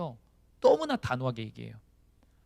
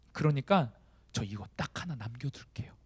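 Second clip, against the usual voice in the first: hushed bargaining; 6 dB quieter than usual. Acted. Believer quietly asking God to keep one sin, hushed bargaining. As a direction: bargain under your breath.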